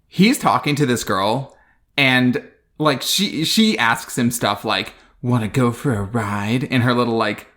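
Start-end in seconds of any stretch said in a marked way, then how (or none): 5.2-6.6 a touch of wispy, airy tone; lightly voiced come-on, airy. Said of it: "wispy, airy hooker voice".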